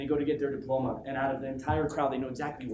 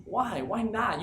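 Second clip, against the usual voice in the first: ordinary talk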